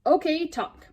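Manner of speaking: loud, high, bossy order; a boy ordering a parrot to talk; impatient demand. Acted. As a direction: snap it at the bird.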